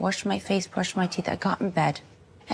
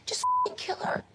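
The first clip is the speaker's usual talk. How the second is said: high, slow mock snarl fading to a whisper; hammed-up cold order; imitating second robber. Retelling the second robber's order in a high, fading whisper. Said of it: "the other guy was going".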